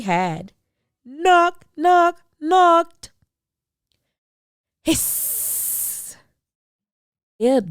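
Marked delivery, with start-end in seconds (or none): 1.0-3.1 loud, high knock, knock, knocked; loud and high knocking effect. A narrator's reading. Knocking on castle door. 4.8-6.2 high sharp hiss; startling breathy hiss. A narrator's reading. Scary hiss from door.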